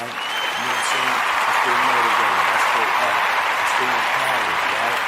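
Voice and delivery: loud motivational shouting; preacher-style empowerment shout; hype shout. Real, not acted. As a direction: pump up the listeners at full volume. Hype shout over music.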